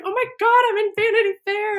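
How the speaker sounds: high squealing mock excitement; excited. Teen girl gushing about her magazine feature, high and excited. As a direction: gush like a starstruck teen. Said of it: "she's, like, super excited".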